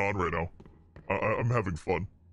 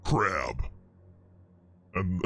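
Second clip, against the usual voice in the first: demon voice changer; 9 semitones lower than usual. Real, not acted. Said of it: "same demon voice you use".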